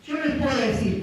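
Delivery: ordinary talk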